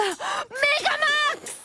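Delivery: high, drawn-out fangirl shout; swooning over-the-top outburst; ecstatic exclamation. Acted. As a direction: announce it like a finishing move. Swoons over maximum cuteness.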